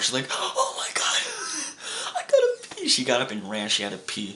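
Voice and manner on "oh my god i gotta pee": high squealing laughter through the words; laughing hard. Retold or imitated. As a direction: laugh so hard you can barely get words out. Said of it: "she was laughing so hard".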